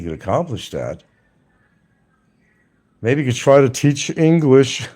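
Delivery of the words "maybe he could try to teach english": laughing jab. Real, not acted.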